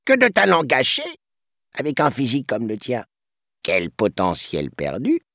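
renard flatteur, voix sucrée; voix mielleuse. A narrator's reading. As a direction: flatte comme un escroc charmeur. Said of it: "susurra Gédéon d'une voix mielleuse". (voix mielleuse de renard)

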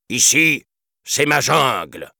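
high, forceful commander voice; imperious declaration. A narrator's reading. Elephant chief claiming his jungle, imperious. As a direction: lay down the law loudly. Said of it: "déclara Hati".

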